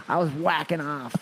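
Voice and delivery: lightly put-on New York accent; New York accent, only hinted. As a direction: toss it off like a New Yorker. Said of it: "a New Yorker thing to say".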